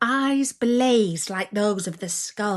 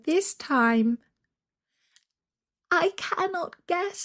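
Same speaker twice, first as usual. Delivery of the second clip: put-on higher, small pleading girl's voice; a lost child begging her doll for help; frightened little girl; 7 semitones higher than usual.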